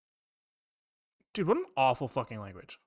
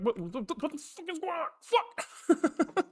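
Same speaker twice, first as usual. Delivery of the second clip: rising into a much higher, strangled cry of frustration; exasperated outburst; 11 semitones higher, 3 dB quieter, slower than usual. Real, not acted. Stuck coder losing it over the language, high exasperated cry. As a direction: throw the last word out in despair. Coder baffled by confusing array code.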